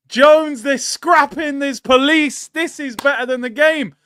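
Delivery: ordinary talk, nothing marked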